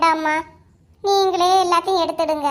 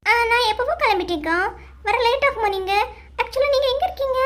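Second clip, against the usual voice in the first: stylish talk; 6 semitones higher than usual. Acted. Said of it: "நீ ஸ்டைலா அவனும் பேசண்டா".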